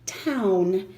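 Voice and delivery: "Town" said with a clear "ow" vowel; syllable sounded out. Shown as a demonstration. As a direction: model the second syllable "Town". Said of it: "pronounce that with an ow".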